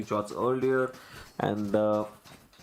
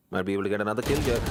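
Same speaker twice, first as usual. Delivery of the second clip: ordinary delivery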